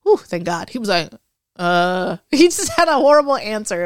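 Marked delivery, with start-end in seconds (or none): 1.6-3.8 laughing through the words. Real, not acted. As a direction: chuckle as you say it. Laughs while telling.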